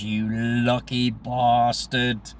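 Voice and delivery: slow, drawn-out "lucky bastard"; relishing the good-natured insult; mock-envious exclamation. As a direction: stretch out each word in playful envy. Friend's envious reply to trip news.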